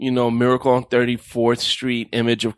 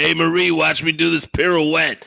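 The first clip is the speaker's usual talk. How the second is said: lightly voiced show-off guy, raised pitch; pudgy middle-aged guy; 4 semitones higher than usual. Pudgy skater calling to Marie, lightly acted show-off. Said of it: "forty-five-year-old overweight pudgy guy".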